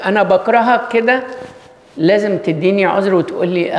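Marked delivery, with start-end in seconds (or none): 0.0-1.2 mock hostile outburst. Preacher voices someone insulting a friend, mock hostile outburst. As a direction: fling the hurtful words. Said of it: "لو أنا قلت لك".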